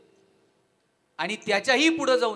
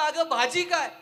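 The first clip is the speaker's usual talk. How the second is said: higher, louder shout at the kitchen door; 7 semitones higher, 6 dB louder, slower than usual. Retold or imitated. Husband yells from the kitchen doorway to his wife, higher and louder. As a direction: yell it into the kitchen. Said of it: "किचनच्या दारातून ओरडला".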